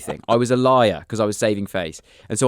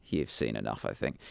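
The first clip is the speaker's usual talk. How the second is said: much softer, dignified parting shot; hushed parting line; 12 semitones lower, 13 dB quieter than usual. Retold or imitated. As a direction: say it low, like a dignified exit. Looking back at the class.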